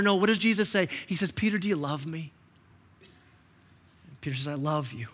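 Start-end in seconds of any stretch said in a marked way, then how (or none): 1.3-2.3 soft, gentle voicing of Jesus; hushed, tender Jesus asking Peter; tender question. 4.5-5.1 quiet, tender confession; hushed Peter answering; soft reply. Acted.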